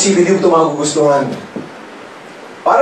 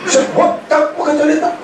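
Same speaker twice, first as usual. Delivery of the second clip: even higher, laughing squeal; squealing mockery; 10 semitones higher than usual. Topping his own mockery of the sponsor with a laughing squeal. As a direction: push the mock plea into a laughing squeal. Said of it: "Inunahan ko na".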